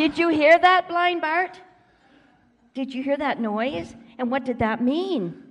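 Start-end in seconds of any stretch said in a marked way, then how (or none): 0.0-1.6 loud, higher-pitched excited asking; excited question. Acted. Storyteller asks blind Bart about the noise, excitedly. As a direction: ask it loudly, as if thrilled by the noise.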